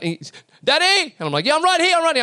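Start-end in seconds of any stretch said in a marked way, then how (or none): 0.6-1.1 high-pitched yell imitating a little boy; child yelling out. Son on the bike calling to his dad, imitated yell. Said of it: "and he yells out". 1.5-2.2 high-pitched, hurried call of reassurance; acted dad calling out to his child; calling back. Father holding the bicycle answers his son, high acted call.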